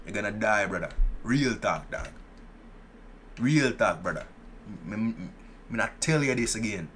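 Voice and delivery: playful put-on Jamaican accent. Streamer warning Jamaican players off, mock Jamaican accent. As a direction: warn them off in a mock Jamaican patois. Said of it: "for the Jamaicans out there".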